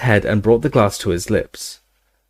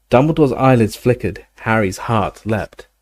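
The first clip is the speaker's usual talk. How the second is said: plain reading voice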